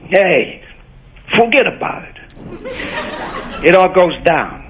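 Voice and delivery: Italian New York, tune falling at the end; Italian New York accent with a falling end; Italian New York mobster. Shown as a demonstration. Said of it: "a classic mobster saying is".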